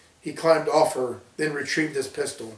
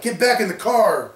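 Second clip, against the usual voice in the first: raised, urgent call; 6 semitones higher, 4 dB louder than usual. A narrator's reading. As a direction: call him back into the car. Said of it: "Becky called out".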